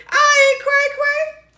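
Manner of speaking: very high, slow put-on voice; playful squeal. Woman joking about her own sanity, high mock squeal. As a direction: squeak it out, drawn out.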